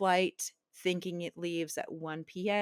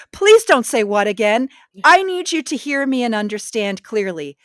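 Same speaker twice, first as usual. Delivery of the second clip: louder, drawn-out orders; loud, slow commanding; 4 semitones higher, 12 dB louder, slower than usual. Retold or imitated. She shouts orders to her husband over a bad phone line, slowly and loudly. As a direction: make him hear each word.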